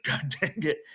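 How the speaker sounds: frustrated. Retold or imitated. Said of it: "She was crying 'cause, like".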